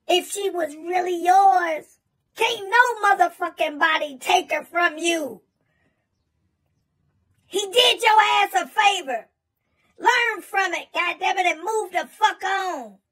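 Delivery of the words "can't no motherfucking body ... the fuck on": ordinary delivery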